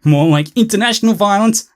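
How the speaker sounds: usual speaking voice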